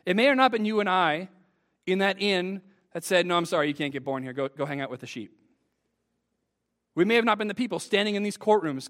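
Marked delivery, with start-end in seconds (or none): 3.2-5.4 lightly voiced innkeeper, low and quick; a low, offhand brush-off, lightly acted; dismissive innkeeper. Innkeeper turning away a birth, low and dismissive.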